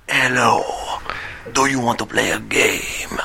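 low, ominous whispered villain voice; Spanish Jigsaw whisper. Retold or imitated. Jigsaw taunting his victim, ominous low whisper. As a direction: menace them in a hushed low whisper. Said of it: "that really ominous whisper".

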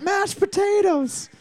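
high, drawn-out golf crowd yell; mock fan yell. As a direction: call it out like a fan at the Masters. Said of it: "after every hit".

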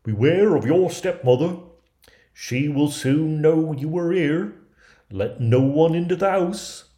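high, loud dwarf voice; storybook dwarfs sounding a caution; warning dwarfs. A narrator's reading. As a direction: warn urgently in a squeaky dwarf voice. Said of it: "the good little dwarves warned her".

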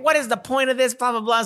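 high-pitched mock tirade; exasperated old lady impression, pitched high; yelling grandma. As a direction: imitate a ranting grandma, trailing into blah-blahs. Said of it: "And she's yelling about shit".

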